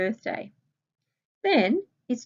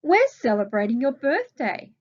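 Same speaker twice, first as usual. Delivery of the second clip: cheerful; 4 semitones higher, 6 dB louder than usual. Acted. Reading David's quoted reply.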